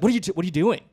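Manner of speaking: mock-indignant questioning; antagonizing. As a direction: imitate a comic confronting a talker. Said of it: "like, antagonizes him".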